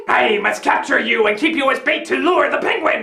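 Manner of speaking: low-pitched mock villain; lightly voiced villain. Villain captures Lucy as bait, lightly hammed low voice. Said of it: "Del Macho comes across human boobies and is like".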